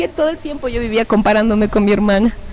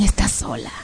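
low, hushed, voiceless taunt; horrible demon voices; 10 semitones lower, 6 dB quieter than usual. Acted. Demons tell her she is alone, horrible voice. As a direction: murmur it like a sinister demon. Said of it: "unas voces horribles que decían".

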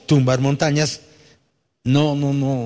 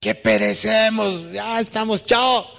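high panicked shout; desperate cry; 9 semitones higher, much slower than usual. Acted. Disciples shouting to their teacher in the storm. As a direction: yell that you're going under.

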